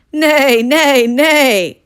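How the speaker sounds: high, loud complaining neighs; whinny like a grumpy horse. Acted. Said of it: "complained the little chicks".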